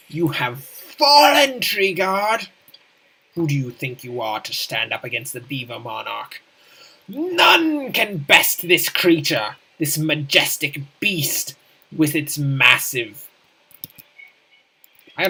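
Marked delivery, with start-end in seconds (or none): 0.1-2.5 booming villain threat, much higher and louder; villain battle cry. Acted. Gloating over fallen tree guard. 7.1-13.3 grand boast. Acted. Boasting as the beast.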